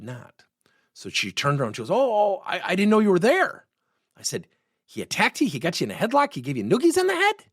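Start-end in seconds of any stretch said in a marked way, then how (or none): 1.9-3.7 caught off guard. Retold or imitated. Emily caught gossiping, turns round. 4.8-7.4 high, rising disbelieving questions; imitating an incredulous challenge, pitch climbing to the end; mock incredulity. Coach mockingly questions Emily's exaggeration.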